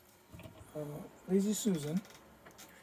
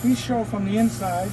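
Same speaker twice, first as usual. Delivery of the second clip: louder, raised voice over the fan noise; talking over fan; 8 dB louder than usual. Real, not acted. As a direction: project to be heard above the booth fan. Talking over paint booth fan.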